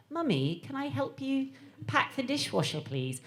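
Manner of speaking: put-on small, soft posh English boy; polite little North London voice, softly posh; posh English child. Son offering mum help, posh English accent. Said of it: "fairly posh English".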